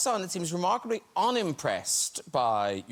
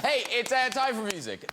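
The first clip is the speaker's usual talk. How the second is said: ordinary talk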